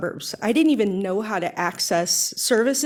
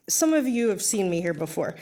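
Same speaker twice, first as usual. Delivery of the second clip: normal talking voice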